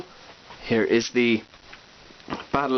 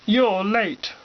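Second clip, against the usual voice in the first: Frodo voice; 9 semitones higher than usual. Retold or imitated. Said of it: "Here's Frodo going".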